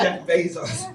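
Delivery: real laughing correction; amused self-correction. Real, not acted. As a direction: laugh at your own slip while correcting it. Laughs at own mixed-up name.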